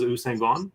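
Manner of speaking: "Usain Vaughn" said as oo-SANG VAWN; pronunciation as heard. Shown as a demonstration. Explaining how he always heard it, "Usain Vaughn" as oo-sang vawn. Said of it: "that's how I've always heard it".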